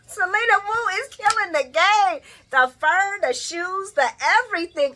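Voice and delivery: loud, high, thrilled praise; hyped fan gushing over a dress; gushing excitement. Real, not acted. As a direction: rave about her look at the top of your voice. Gushing over her outfit.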